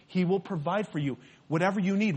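usual speaking voice

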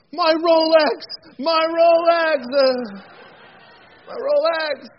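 high, very loud anguished cry; hammed-up scream of loss, drawn out; horrified wail. Banker noticing his lost arm and watch, hammed-up horrified wail. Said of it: "looks over and goes".

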